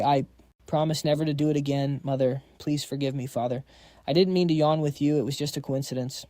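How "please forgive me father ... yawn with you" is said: ordinary talk, nothing marked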